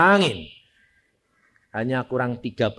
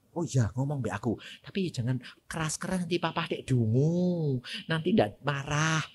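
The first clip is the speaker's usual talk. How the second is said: softer, hurried hushed voice; hushed warning; 2 semitones lower, 10 dB quieter than usual. Retold or imitated. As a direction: keep it down so father won't hear. Sister warns to keep quiet.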